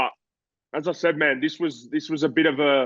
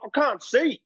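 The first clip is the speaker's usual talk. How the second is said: high, helpless protest; mock panic; 11 semitones higher, slower than usual. Boxer with bandaged eye answering his coach, high mock panic. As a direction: plead that you can't see. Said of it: "And I'm like".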